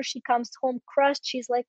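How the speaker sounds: ordinary delivery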